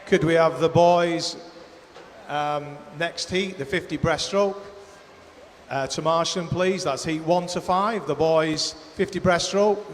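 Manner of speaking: tannoy call to report; airport announcement. Real, not acted. PA call for boys' heat.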